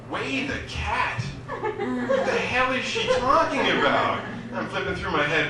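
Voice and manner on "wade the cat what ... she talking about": high, slow puzzled echo of the phrase, laughing; baffled repeat. Retold or imitated. Confused comic echoes a stranger's words in a baffled voice. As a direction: repeat it back, totally confused. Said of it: "I'm like".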